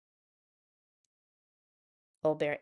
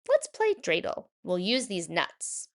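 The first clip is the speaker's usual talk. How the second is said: Bubba Braina. Acted. Old baker proposes game.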